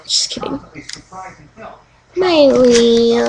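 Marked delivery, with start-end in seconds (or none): 0.0-0.6 whispered joke aside. Real, not acted. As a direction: whisper it under your breath.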